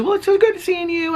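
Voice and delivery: very high, sugary greeting; high sing-song hello; sweet neighbor voice. Retold or imitated. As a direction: imitate a syrupy polite neighbor.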